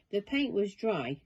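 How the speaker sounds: usual speaking voice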